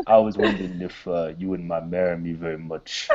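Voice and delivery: low, soft movie boxer impression; Rocky Balboa. Boxer proposing marriage, low soft mock movie voice. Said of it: "I've got this Rocky Balboa".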